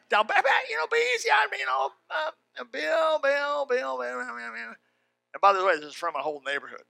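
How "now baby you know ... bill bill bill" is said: much higher pleading woman's voice, fading out; pleading wife. Retold or imitated. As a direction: beg him to go easy, in a high wife's voice. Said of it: "Kathy's crying".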